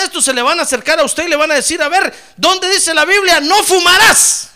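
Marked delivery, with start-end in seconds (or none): 1.8-4.5 high, loud taunting question; a hammed-up scoffer demanding proof; mock challenger. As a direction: needle the believer mockingly. Mocking challenger.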